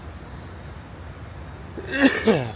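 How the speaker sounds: dry cough; a harsh unvoiced cough; real cough. Real, not acted. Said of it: "Apologies for my coughing".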